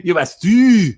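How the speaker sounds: high, loud Scottish outburst; Scottish accent. Shown as a demonstration. Actor repeating his stage line's ending, Scottish accent. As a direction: wail it in broad Scottish, drawn out at the end. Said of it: "in my best Scottish accent".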